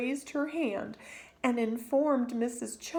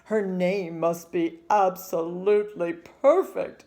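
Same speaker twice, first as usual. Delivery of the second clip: a hint of a proud father, louder; firm, proud declaration; father's voice; 2 semitones lower, 7 dB louder than usual. Father declares the baby's name must be perfect, proudly. Father speaks over newborn.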